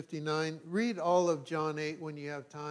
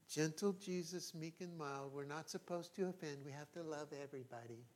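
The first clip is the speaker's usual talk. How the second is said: much softer, sugary parody of a soft-spoken liberal; mock liberal voice; 14 dB quieter than usual. Pastor mocking timid liberal Christians, soft and cooing. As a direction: coo it gently, mocking a timid churchgoer. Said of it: "That's my liberal voice".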